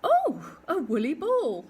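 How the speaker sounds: put-on high, loud, delighted little girl; a girl crying out at a fluffy ball rolling to her feet. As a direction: squeal with joy at the find. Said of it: "cried the little girl".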